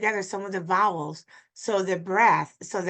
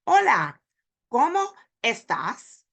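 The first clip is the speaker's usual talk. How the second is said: strange disconnected words; 3 semitones higher, 4 dB louder than usual. Acted. Teacher imitating a strange Spanish speaker, disconnected words. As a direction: leave big pauses between Hola, cómo and estás. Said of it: "very strange if a Spanish speaking".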